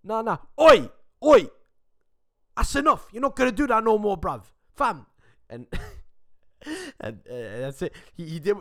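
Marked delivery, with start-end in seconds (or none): 0.0-5.1 loud, high London voice shouting Oi. Retold or imitated. London boyfriend shouting at the old Greek man, loud London accent. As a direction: bark it like a London lad stepping in. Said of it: "He's from London, remember? And he goes".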